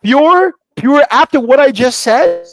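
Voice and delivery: high, loud astonished repeat; amazed excitement. Retold or imitated. Retelling to host how the meaning matched, amazed.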